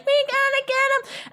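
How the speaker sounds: put-on high, pleading child's voice; kid pleading. Kid insisting to the parent, high eager child voice. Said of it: "But the kid is like".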